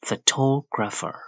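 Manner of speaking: phoTOgrapher, second syllable lifted; stress on TO. Shown as a demonstration. Teacher shows where pitch rises, stressed TO in Photographer. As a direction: hit TO in Photographer. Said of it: "Toe ဆိုပြီးမြင့်ပေးရတယ်".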